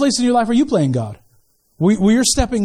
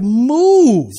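a drawn-out "move", lightly voiced as God; God ordering people aside; 4 dB louder than usual. God to people stepping in the way, commanding. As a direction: stretch it into a firm command. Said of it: "and God's like".

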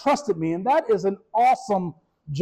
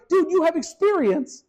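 exasperated; 8 semitones higher than usual. Retold or imitated. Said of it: "that's all I could think is".

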